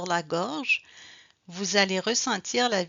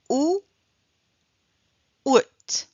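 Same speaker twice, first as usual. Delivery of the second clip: "Ou" then "out", both loud and higher; loud sound demo; 6 semitones higher, 7 dB louder than usual. Shown as a demonstration. Teacher contrasting two words for learners. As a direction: call out "ou" and then "out". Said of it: "Some pronounce the T".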